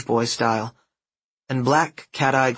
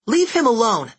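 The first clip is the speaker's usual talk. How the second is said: put-on high, loud girl's command; ordering; 10 semitones higher, 4 dB louder than usual. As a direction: order them off. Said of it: "she ordered".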